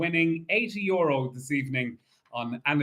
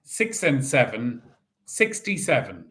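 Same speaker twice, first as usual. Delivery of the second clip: bingo caller. Acted. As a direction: call each ball out steadily. Calls bingo numbers.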